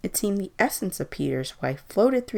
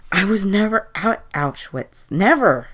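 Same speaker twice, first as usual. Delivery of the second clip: higher, louder protest; emphatic denial; 5 semitones higher, 7 dB louder than usual. A narrator's reading. Old man denying he was at Auschwitz, loud protest. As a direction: deny it loudly, punching the last word.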